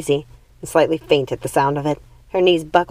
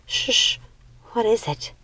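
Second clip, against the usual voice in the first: frightened hush; 6 semitones higher, 5 dB quieter, slower than usual. A narrator's reading. Frozen by a snapping twig.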